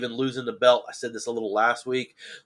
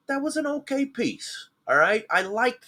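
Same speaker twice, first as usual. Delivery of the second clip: grudging, surprised praise; 12 semitones higher than usual. Real, not acted. Grudging approval.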